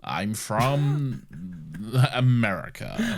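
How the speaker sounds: stretched, broken-up fake American accent; laughing, overdone American accent; mock American accent. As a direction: ham it up while laughing through it. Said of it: "Doctor Strange does his American accent".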